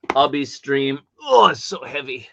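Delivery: usual speaking voice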